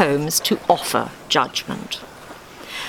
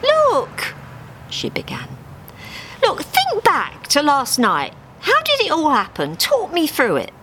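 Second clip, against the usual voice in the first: Harry coaxing; 11 semitones higher than usual. Acted. Harry comforting Dave with a wing round him, gentle. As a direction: talk him through it kindly.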